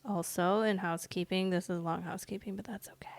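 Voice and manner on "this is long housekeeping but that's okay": quick whispered aside. Real, not acted. Whispered aside about housekeeping.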